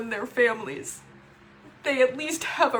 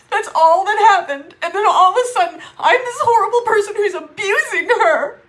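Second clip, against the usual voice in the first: louder and higher, tears near; upset loud outburst; 7 semitones higher, 9 dB louder than usual. Real, not acted. Called an abuser by her sister, loud upset. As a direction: let the outrage burst out.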